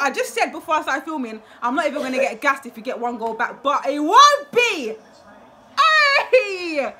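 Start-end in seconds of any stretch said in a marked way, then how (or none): 3.6-6.9 loud, slow triumphant cry; real elated shout with a laugh; excited yell. Real, not acted. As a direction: belt it out in joy. Celebrating the goal.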